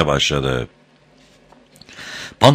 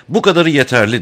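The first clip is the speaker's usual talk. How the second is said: plain reading voice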